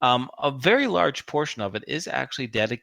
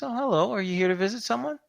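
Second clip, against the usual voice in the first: bright, higher, quick front-desk greeting; chirpy receptionist; 10 semitones higher, faster than usual. Acted. Receptionist greeting a visitor at the desk, higher and quick. GM voices nursing home receptionist.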